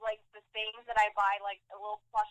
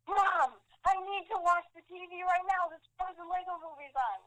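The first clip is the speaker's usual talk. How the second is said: high-pitched re-enacted call to Mom; hammed-up excited pleading; urgent excited plea; 8 semitones higher than usual. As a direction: beg a parent urgently, pitched right up. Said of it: "I was like".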